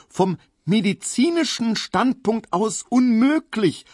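cling to his rehearsed phrase; desperate recited protest. A narrator's reading. Emil recites his speech.

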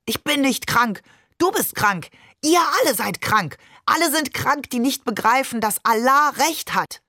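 high-pitched furious accusations; narrated angry yell, pitch pushed up. A narrator's reading. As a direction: cry out in rising outrage, without much extra volume. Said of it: "Und dann schrie er los".

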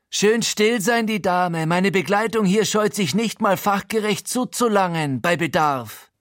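high, cocky gangster voice; großspuriger Befehl. A narrator's reading. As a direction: shut them up with a swaggering threat. Frankie kommandiert die Geiseln herum. (hohe, freche Gaunerstimme)

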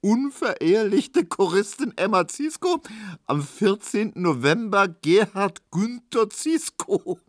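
high, gleeful reading broken by laughs; laughing shouting. A narrator's reading. Tesch showing everyone the paper, laughing and shouting. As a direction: crow it out, beside yourself with amusement. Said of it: "lachte und schrie".

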